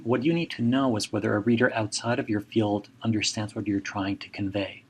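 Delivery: ordinary talk, nothing marked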